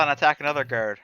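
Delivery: normal talking voice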